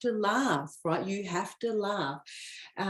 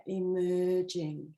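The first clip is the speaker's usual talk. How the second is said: slow savoring; much slower than usual. Shown as a demonstration. Coach savoring the card's word emerging, drawn out slowly. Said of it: "Look at that".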